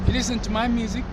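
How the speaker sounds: ordinary talk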